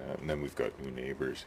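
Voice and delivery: ordinary delivery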